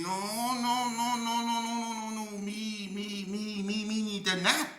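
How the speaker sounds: high squeaky chant of no, no, no; insistent old man. Retold or imitated. Old man refusing to wait for his money, high squeaky insisting. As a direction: keep it high and pushy.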